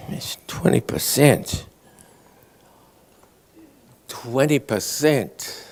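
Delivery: drawn-out, soft, baffled repeating; mild confused mumbling to himself; slow puzzled muttering. Retold or imitated. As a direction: slowly chew over the number, lost. Loveless puzzling over the math.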